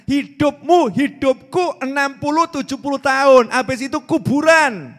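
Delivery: fiery sermon climax; forceful preaching. Acted. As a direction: drive the point home from the pulpit.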